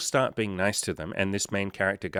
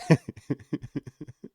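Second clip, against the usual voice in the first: real laugh; 7 semitones higher than usual. Real, not acted. Laughs at his own punchline.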